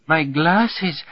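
high snivelling wail. A narrator's reading. Secretary crawling for her broken glasses, snivelling. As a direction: whine it high and tearful. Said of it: "snivels Fräulein Müller-Hochleben".

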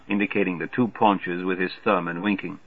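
plain reading voice

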